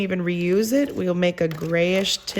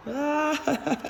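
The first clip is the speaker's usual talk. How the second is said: laughing; 9 semitones higher, slower than usual. Real, not acted. Laughs at the mess.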